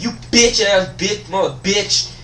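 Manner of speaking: high, run-on cursing tirade; mock inner rant. Student silently cursing out administrator, mock-furious rant. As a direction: let the insults spill out in a high ranting stream. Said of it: "I'm thinking in my head".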